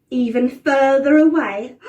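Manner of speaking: slow, loud and ominous; dramatic emphasis. A narrator's reading. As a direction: lean hard into the threat, drawn out. Dramatic storytelling to children.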